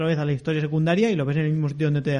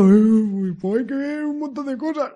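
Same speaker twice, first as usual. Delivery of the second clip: village simpleton voice; 8 semitones higher than usual. Acted. The simpleton answers the tourist in a high, dopey voice. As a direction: play the cheerful village fool, much higher. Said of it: "Entonces el tontico del pueblo".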